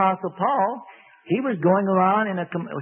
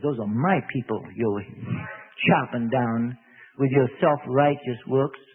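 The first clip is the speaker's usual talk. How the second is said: Lord rebuking; 8 semitones lower than usual. Acted. The Lord rebukes Saul, low.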